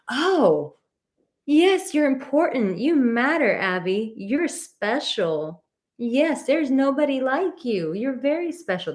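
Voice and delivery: high, sing-song praise for the dog. Real, not acted. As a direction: gush over the dog, high and sweet. Reassuring dog she matters.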